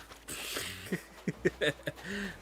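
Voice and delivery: high, breaking chuckle; laugh. Real, not acted. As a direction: laugh at your own bad memory. Laughs at own cat story.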